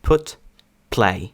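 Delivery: put, play one at a time, p bursting with air; English put and play with a puffed p; strong aspiration on P. Shown as a demonstration. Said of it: "наскільки сильний йде придих перед звуком".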